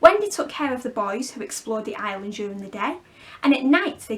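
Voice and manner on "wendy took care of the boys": ordinary delivery, nothing marked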